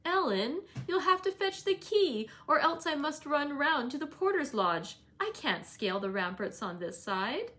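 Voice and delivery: put-on high, bright girlish call over the wall; laughing exclaim. Cathy calling to Ellen from beyond the wall, excited. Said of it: "laughing and exclaiming".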